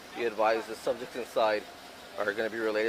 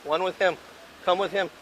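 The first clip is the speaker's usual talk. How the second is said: shouting; 9 semitones higher, 8 dB louder than usual. Real, not acted. Officers calling out during rescue.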